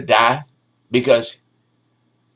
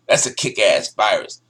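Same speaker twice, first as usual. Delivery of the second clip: ordinary talk, nothing marked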